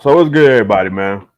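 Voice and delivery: loud upbeat greeting; loud hype host greeting. Real, not acted. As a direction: call out to the audience with energy. Show greeting shout-out.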